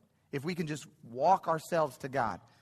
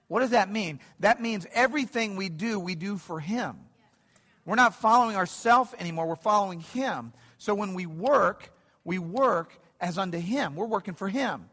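higher, louder sermon build with rising fervour; preaching at full force; 5 semitones higher, 7 dB louder than usual. Acted. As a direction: drive the point home to the congregation.

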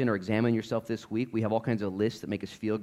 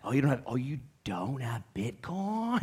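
condescending; 5 dB quieter than usual. Retold or imitated. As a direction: talk down to them, drawing out the question.

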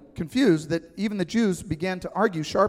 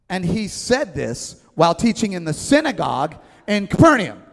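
emphatic reading; 8 dB louder than usual. Acted. Preacher reading text emphatically.

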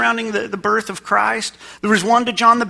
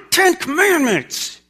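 hammed-up lawgiver announcement; mock pompous God; 9 semitones higher, slower than usual. Preacher playing the God people expected, mock pompous.

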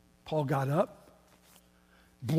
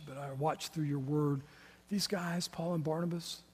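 hushed pastoral prayer closing the sermon; quiet prayer; 2 semitones lower, 5 dB quieter than usual. Real, not acted. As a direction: pray softly and earnestly to God. Leads closing prayer softly.

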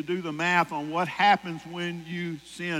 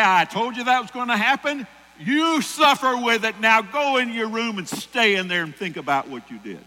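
put-on high, loud scolding at the burned child; angry parent scolding; 6 semitones higher, 7 dB louder than usual. An angry parent shouting at a hurt child. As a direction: yell I told you so at the crying kid. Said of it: "And so you say".

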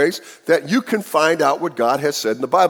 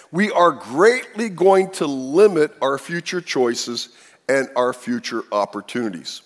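ordinary talk

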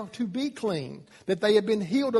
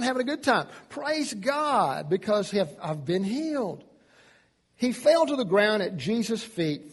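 ordinary delivery

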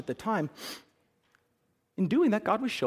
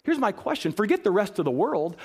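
ordinary delivery

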